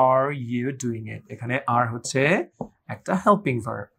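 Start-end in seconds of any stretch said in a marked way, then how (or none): 0.1-1.2 slow careful "are you doing it?" with stress on are. Shown as a demonstration. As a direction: spell it out slowly for learners. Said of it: "একটু খেয়াল করেন". (ধীরে, স্পষ্ট করে বলা)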